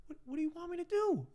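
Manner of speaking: very high-pitched retold exasperation; exasperated retort. Bartender pushed by angry customer to act, high exasperated retort. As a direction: throw the voice way up in helpless protest.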